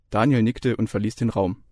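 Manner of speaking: ordinary delivery, nothing marked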